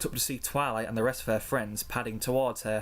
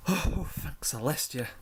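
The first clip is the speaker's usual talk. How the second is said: slow, higher-pitched exhale of relief; relieved sigh; 4 semitones higher, slower than usual. A narrator's reading. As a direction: let the words out on a relieved breath. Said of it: "All the ponies sighed with relief".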